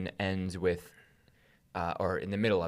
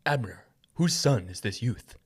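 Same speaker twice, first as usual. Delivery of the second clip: king's voice; 3 semitones higher, 4 dB louder than usual. Acted. Voicing King Saul in reading.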